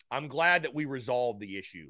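ordinary delivery

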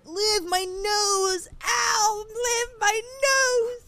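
high, drawn-out pained whine; whiny Cam impression. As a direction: whine pitifully like a suffering boyfriend. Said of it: "That's what I sound like?".

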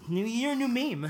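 high, loud punchline voice; punny slogan. Pretend. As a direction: land the pun like a catchphrase. Punning on his slogan.

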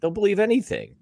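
mocking disbelief. Real, not acted. Sneering at gullible masses.

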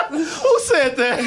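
slow incredulous question; slow laughing disbelief. Real, not acted. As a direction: drag it out, laughing.

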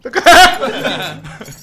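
high, loud burst of laughing; high-pitched cackle; loud laughter. Real, not acted. Laughing at the joke.

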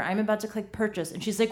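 ordinary talk, nothing marked

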